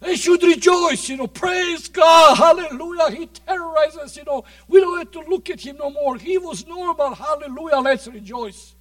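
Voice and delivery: loud, high, exclaiming to God in disbelief; preacher's excited retort. Retold or imitated. Preacher retelling his complaint to God, high excited shouting. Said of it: "I said".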